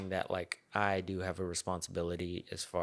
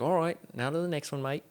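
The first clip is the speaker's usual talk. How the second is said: Steve Irwin; 8 semitones higher, 6 dB louder than usual. Retold or imitated. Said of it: "He's like".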